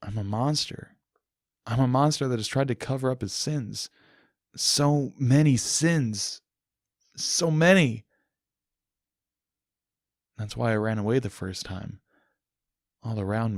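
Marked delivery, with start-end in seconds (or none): 4.5-8.0 slow, higher, louder grief; guilt-stricken wail; anguished lament. Acted. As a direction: drag the words out in despair. Narrator's guilt peaks.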